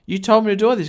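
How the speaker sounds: high, loud retold accusation; indignant protest. Agent confronting the client who turned on him, indignant. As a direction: throw the blame back, pitched high.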